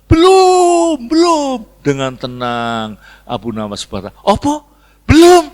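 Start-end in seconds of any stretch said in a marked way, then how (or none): 0.1-1.7 high, loud drawn-out crowd answer; crowd shouting back. Acted. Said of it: "Semua dia". 4.2-5.5 high, loud incredulous echo; feigned outraged disbelief. Acted. Abu Nawas feigns outraged disbelief.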